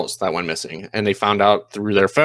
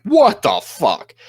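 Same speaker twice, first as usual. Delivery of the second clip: slow, high, louder outburst of betrayal; imitating a husband learning of the affair; hurt disbelief; 9 semitones higher, 4 dB louder, much slower than usual. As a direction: drag it out like you can't believe what you heard.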